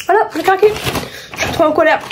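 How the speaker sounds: high, whiny voice, half laughing at herself; whiny confession. Real, not acted. Confessing a chocolate binge.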